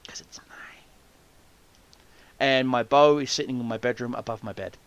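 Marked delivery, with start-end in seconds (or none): 0.1-0.8 whispered joke, unvoiced; whispered aside. Real, not acted. Joking to listeners in a whisper. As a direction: breathe it as a secret aside.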